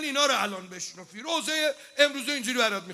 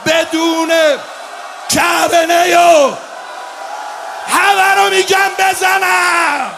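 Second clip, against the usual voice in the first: louder, higher, very slow climactic cry; preaching at full force; 4 semitones higher, 12 dB louder, slower than usual. Acted. Preacher driving the mourners to a wailing climax, loud slow cry. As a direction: build the lament to a wailing peak.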